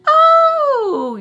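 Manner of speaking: very high, loud yelp of an oh; startled oh. Retold or imitated. Caught off guard by Sam, high startled yelp. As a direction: jump out of your skin on oh.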